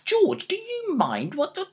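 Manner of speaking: high, indignant protest; exasperated. Acted. Dad objecting to George's list of ailments, exasperated. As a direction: cut in, affronted.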